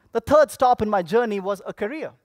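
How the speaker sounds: normal talking voice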